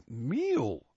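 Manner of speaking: ordinary delivery, nothing marked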